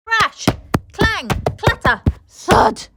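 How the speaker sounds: high, loud crash words; dramatic crash sounds. A narrator's reading. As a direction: bang out the crash noises. Crash noises read dramatically.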